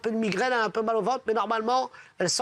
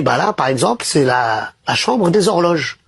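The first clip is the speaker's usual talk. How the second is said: lower, lightly voiced Saint Peter; a hint of a solemn guide; 5 semitones lower, 9 dB louder than usual.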